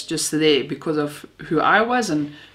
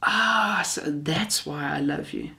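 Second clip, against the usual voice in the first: grandmother's reply; 6 dB quieter, slower than usual. Retold or imitated. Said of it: "And she said".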